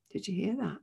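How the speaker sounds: ordinary talk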